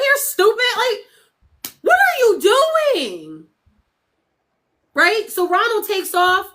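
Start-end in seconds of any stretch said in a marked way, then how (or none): 0.1-3.5 exasperated yell. Retold or imitated. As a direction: yell at him in disbelief. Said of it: "the cop is like".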